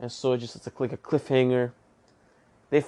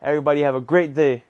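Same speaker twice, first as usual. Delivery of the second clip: upbeat outro; 3 semitones higher, 5 dB louder than usual. Acted.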